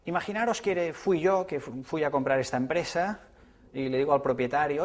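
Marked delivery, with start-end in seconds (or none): none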